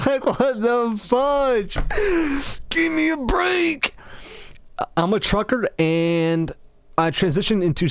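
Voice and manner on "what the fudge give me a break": high, drawn-out protest; mock exasperation. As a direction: wail it slowly in hammed-up disbelief. Exasperated reaction to trucker remark.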